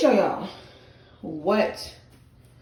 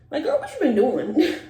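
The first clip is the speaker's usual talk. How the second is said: laughing; 6 semitones higher than usual. Real, not acted. Laughing at her own nails.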